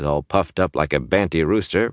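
usual speaking voice

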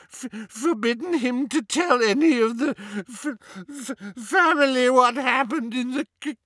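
high, frantic elf voice building to a wail; house-elf near sobbing. A narrator's reading. Elf confessing he failed his orders, breaking down. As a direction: stammer and choke, then wail the last word. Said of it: "Kreacher began to sob so hard".

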